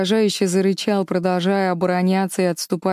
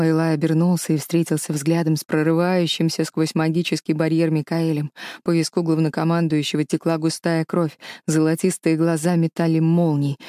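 usual speaking voice

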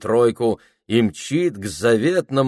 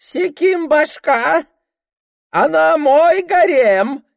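put-on drunken bleating; 6 dB louder, slower than usual. Drunk Ilya in costume.

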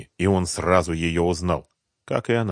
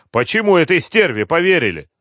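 loud, high indignant question; angry reproach; 9 semitones higher, 6 dB louder than usual. A narrator's reading. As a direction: reproach the gang. Thug angrily questions the retreat.

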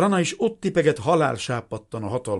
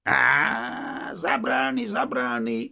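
big drawn-out mocking exclamation, pitched up; guffawing soldier; 5 semitones higher, much slower than usual. A narrator's reading. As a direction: play a hearty Russian laughing it off. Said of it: "mondta az orosz röhögve". (röhögő orosz katona hangján)

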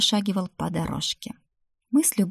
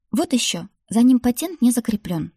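pitched-up dismissive snort; 5 semitones higher, 4 dB louder than usual. A narrator's reading. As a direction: scoff back at grandmother's objection. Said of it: "фыркнула я". (фыркая, с вызовом)

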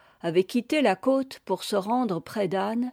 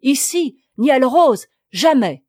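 loud, higher, rising to a cry; agitated cry; 4 semitones higher, 10 dB louder than usual. A narrator's reading. Daughter refusing the monk, crying out in agitation. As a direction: fling the refusal out in alarm. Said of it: "s'écria la fille de Joël avec agitation".

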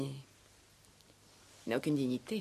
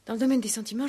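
usual speaking voice

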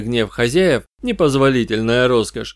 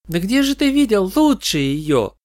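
put-on high, offended young fan; indignant goth girl; 8 semitones higher than usual. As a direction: scoff at the question. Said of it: "сердито тряхнув чёрной гривой".

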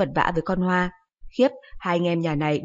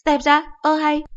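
high, loud exasperated mom; scolding mother; 9 semitones higher, 5 dB louder than usual. Acted.

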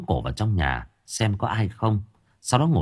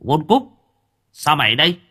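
higher and louder, calling her name out; surprised call; 9 semitones higher, 5 dB louder than usual. Acted. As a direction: catch sight of her and call out. Spotting an acquaintance in a crowd.

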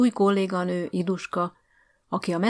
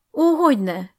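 higher, louder eager oh of course; eager exclamation; 7 semitones higher, 8 dB louder, slower than usual. Acted. Iduska answering the headmaster's church remark, eager exclamation. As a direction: brightly agree.

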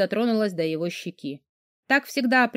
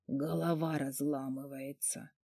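much softer, slow and lower hoarse rasp; hoarse groan; 5 semitones lower, 12 dB quieter, slower than usual. Acted. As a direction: croak it out like a man with a splitting headache. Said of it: "прохрипел Воронцов".

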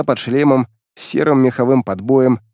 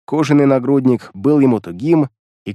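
plain reading voice

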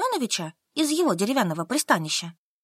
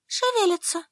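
higher, scared whisper-thin report; Solka frightened; 10 semitones higher than usual. Acted. (выше, испуганно)

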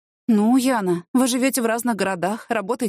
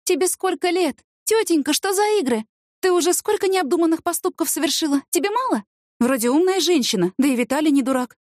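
indignant scolding; 9 semitones higher than usual. A narrator's reading. Asya scolding her friend.

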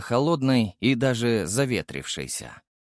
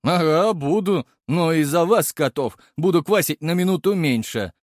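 cheeky retort; 6 semitones higher, 4 dB louder than usual. A narrator's reading. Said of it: "подметил лысеющий".

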